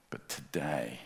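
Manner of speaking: ordinary talk, nothing marked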